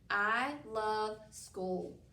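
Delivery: very slow I love school, each word apart. Shown as a demonstration. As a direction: count each word slowly. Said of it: "you say that with me".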